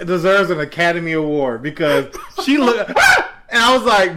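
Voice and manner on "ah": sudden high startled scream; retold yelp. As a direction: jump with a high mock shriek. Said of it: "when she yelled".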